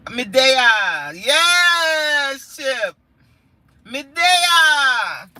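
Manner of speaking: high, loud drawn-out cheer; exuberant holler. Real, not acted. Exuberant Jamaican phrase with chatter.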